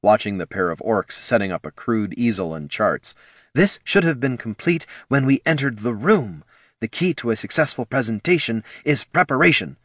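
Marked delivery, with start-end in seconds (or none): 3.5-9.8 a hint of an imperious leader; lightly voiced haughty warrior woman; stern chieftain. A narrator's reading.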